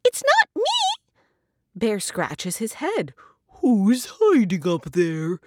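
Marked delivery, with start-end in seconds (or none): 0.0-1.0 very high, louder, stretched mouse squeak; tiny high-pitched mouse voice; squeaky mouse. A narrator's reading. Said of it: "Mouse scurries up and squeaks". 3.6-5.4 louder, slow storybook bear; lightly voiced puzzled bear. Bear scratching head, asks Mouse.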